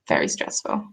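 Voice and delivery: low, near-whispered verdict; hushed aside. Real, not acted. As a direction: drop to a soft, low whisper. Wry verdict on her cat allergy.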